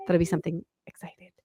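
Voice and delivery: hushed, breathless whisper; soft excited whisper. Real, not acted. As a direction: whisper it like a secret thrill. Excited aside about staying on site.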